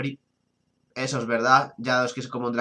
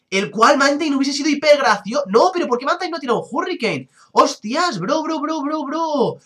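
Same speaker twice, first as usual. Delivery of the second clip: excited; 11 semitones higher, 4 dB louder than usual. Real, not acted. Excited reaction to a Pokémon play.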